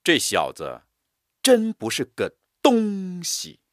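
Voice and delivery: 真不是个东西 stretched high and slow; drawn out scornful stress. Shown as a demonstration. Teacher mocking a rascal, stretched stress. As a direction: drag out 真不是个东西 in disgust. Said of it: "声音拉长、断开".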